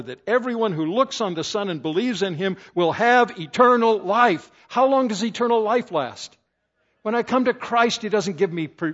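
loud, rising preacher's build; preaching at full force. Acted. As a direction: drive it home to the congregation.

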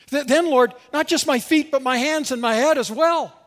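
loud, high, tumbling plea; eager pleading. Acted. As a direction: blurt it out with sudden eagerness. Peter's eager reversal, read aloud.

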